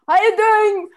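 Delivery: loud, high call in passing; hammed-up shouted farewell; breezy mock greeting. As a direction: toss it out loudly without waiting for answers. Demonstrates uncaring passing greeting.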